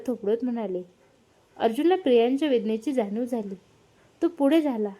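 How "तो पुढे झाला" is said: ordinary delivery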